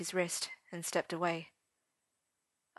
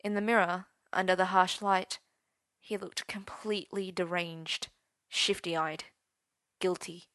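plain reading voice

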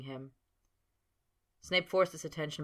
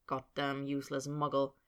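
usual speaking voice

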